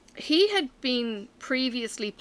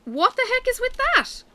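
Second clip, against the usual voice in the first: much higher, louder, odd-voiced exclamation; baffled; 5 dB louder than usual. Real, not acted. Vlogger reacting to something strange, baffled squeal. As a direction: toss it off in disbelief.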